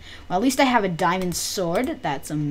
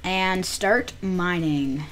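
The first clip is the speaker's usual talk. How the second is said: ordinary talk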